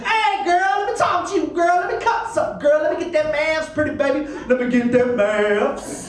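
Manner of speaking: high, persistent come-on voice of a street flirt; relentless mock hustler hitting on a lady; pushy pickup guy. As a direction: keep hitting on her relentlessly. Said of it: "just relentlessly".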